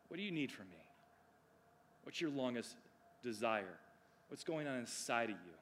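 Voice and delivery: soft, low, unhurried questions; hushed, caring acted question; Jesus asking. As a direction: ask tenderly, leaving room between phrases. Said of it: "He is asking a question".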